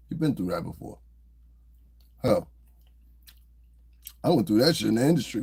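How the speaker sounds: normal talking voice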